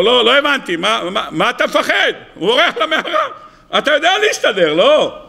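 high-pitched, teasing disbelief; incredulous challenge. Acted. Teacher challenges why the rabbi flees, incredulous. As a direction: question it as if it makes no sense.

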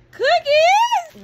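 high, loud delighted gasp of a word; surprised squeal. Real, not acted. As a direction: shriek it in happy disbelief. Surprise cookies from partner.

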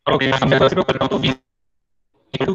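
choppy robotic call audio; glitching online voice; voice breaking up. Real, not acted. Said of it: "sir, voice break हो रही है". (टूटती हुई online आवाज़)

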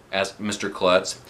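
usual speaking voice

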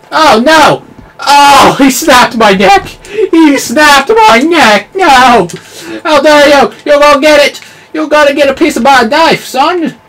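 high, loud indignant threats; real furious yelling; outraged shout. Real, not acted. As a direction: yell back at the player who got you. Killed by enemy, vows revenge.

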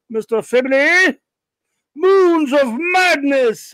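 high, slow, loud proclamation of the title; shouting. Acted. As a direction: boom it out like a hammy villain. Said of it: "Sorry for the shouting".

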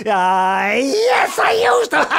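very high, loud cry; wailing confession. Acted. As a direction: wail out the confession.

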